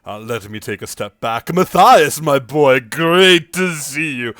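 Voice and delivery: loud, hearty gentleman's greeting; hearty suitor. Acted. Aaron greets Matthias with loud, hearty charm. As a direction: boom out a charming hello. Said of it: "He goes".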